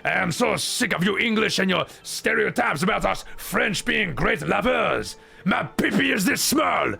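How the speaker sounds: mock a Brit doing a cheesy French accent; over-the-top French accent impression that ends yelling; terrible French accent. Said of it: "a British guy doing a terrible French accent".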